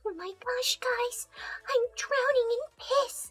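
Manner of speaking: high, breathy-whispered cutesy pony voice; mock cutesy pony whimper; meme quote voice. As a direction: squeak it out like a tiny pony in distress. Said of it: "that shit I was quoting".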